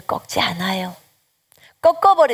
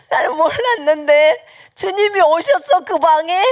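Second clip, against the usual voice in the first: much higher and louder, voice breaking with tears; tearful surprise; 8 semitones higher, 5 dB louder than usual. Retold or imitated. Mother learns the Lord came to her room, crying in amazement. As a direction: gasp it out, moved to tears.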